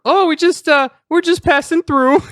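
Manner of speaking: player's character nervously answering the woman; nervous traveller. Acted. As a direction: squeak it out awkwardly.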